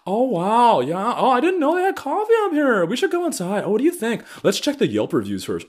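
high mocking yuppie chirp; mock tourist couple. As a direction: gush like a naive out-of-towner. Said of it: "being like".

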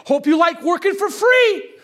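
high, loud, taunting; gloating scold. Retold or imitated. Dad tells son his allowance is gone, gloating shout. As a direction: rub it in hard. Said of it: "I said".

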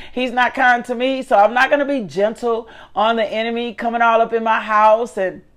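ordinary talk, nothing marked

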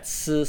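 "s" with a sharp falling tone; Mandarin si. Shown as a demonstration. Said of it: "use it in the fourth tone".

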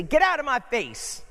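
sharp, loud and high; angry. Retold or imitated. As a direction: bark it at someone crowding you. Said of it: "you ever hear somebody say".

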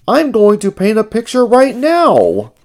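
high, loud, excited character voice; high-pitched puppet-like eagerness; Ernie's voice. A narrator's reading.

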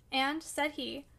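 usual speaking voice

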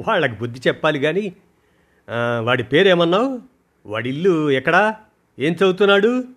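mother scolding daughter lightly, then asking firmly; brushing it off. Acted. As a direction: shrug off the tears then demand answers. Mother dismisses fear, demands details.

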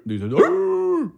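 muy agudo y lento, voz de personaje; alárgalo en voz de caricatura; voz exagerada. Pretend. Repeats in exaggerated voice. (Deezer es duro en voz aguda)

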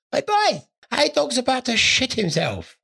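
high, excited retold call; urgent call-over. Telling a workmate about A Dog, excitedly. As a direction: call the lad over, pitched right up.

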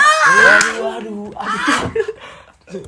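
laughing groan. Real, not acted. Teasing about the ex.

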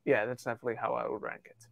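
quick conspiratorial whisper; host whispers agreement with his own ranking quickly. Real, not acted. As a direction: whisper it fast like a secret aside. Joking aside after agreeing.

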